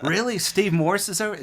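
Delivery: high, loud starstruck disbelief; excited surprise. Retold or imitated. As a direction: squeak it out like a thrilled fan. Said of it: "And I'd be like".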